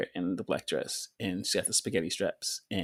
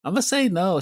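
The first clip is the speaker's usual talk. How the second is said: emphatic; 10 semitones higher, 8 dB louder than usual. Real, not acted. Emphatic verdict.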